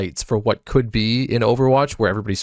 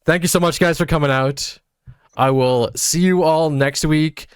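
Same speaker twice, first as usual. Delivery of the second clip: upbeat sign-off; 6 semitones higher than usual. Real, not acted. Host signs off again.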